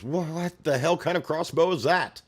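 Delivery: high, loud disbelieving soldier; shocked soldier. Acted. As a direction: yell it like a stunned grunt. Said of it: "one of them goes".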